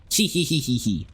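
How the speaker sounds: robot voice. Retold or imitated. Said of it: "robots don't go".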